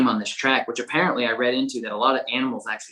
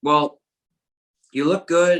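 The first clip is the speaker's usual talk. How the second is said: higher and louder, a mock-dry quip; conductor's wry remark; 6 semitones higher, 5 dB louder, slower than usual. Conductor to the shaken dog walker, higher, lightly imitated dry quip. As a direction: play the conductor sizing him up, lightly voiced. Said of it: "he's like".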